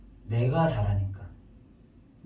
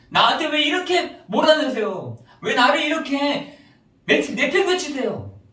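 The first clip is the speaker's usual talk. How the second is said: high, loud volley of aggrieved questions; protesting to God; 9 semitones higher, 5 dB louder than usual. Acted. Believers blame God for their problems, loud high protest. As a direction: wail each complaint at a raised pitch. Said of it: "그렇게 하시더라니까요".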